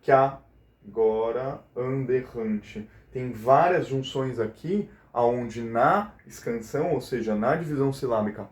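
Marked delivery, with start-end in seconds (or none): 0.0-2.9 verse spaced out in slow chunks; slow scansion. Shown as a demonstration. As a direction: pick slowly through the syllables for the class. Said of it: "a gente não conta duas sílabas".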